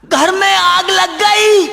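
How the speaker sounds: very high, loud, drawn-out bellow; slow high holler, stretching the end; shouting. Acted. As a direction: bawl it out slowly at full voice. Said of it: "जोर से बता".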